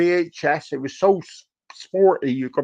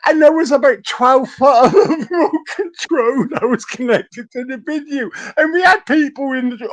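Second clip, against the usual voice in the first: telling it while cracking up; words breaking into laughter; laughing; 10 semitones higher, 4 dB louder than usual. Real, not acted.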